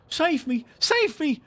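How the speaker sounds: very high frightened plea; desperate cry. A narrator's reading. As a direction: scream for help while fleeing. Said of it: "cried Nibs".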